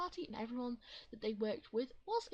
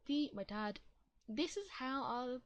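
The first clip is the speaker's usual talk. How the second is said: ordinary delivery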